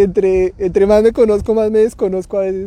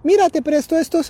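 put-on child offering; 9 semitones higher than usual. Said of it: "me dice".

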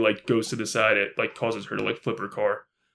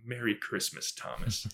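cold quiet taunt; 11 dB quieter than usual. Retold or imitated. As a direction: hush it out like an icy goodbye. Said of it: "She's like".

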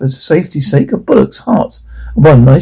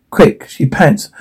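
ordinary delivery, nothing marked

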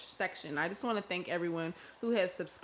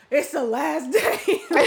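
laughing; 8 semitones higher, 12 dB louder, slower than usual. Retold or imitated. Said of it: "I'm like".

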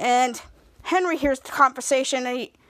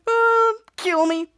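high, drawn-out "uh", slow delivery; disgusted groan; 8 semitones higher, 3 dB louder, much slower than usual. Retold or imitated. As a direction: groan slowly in mock disgust. Brother disgusted by sister's crush.

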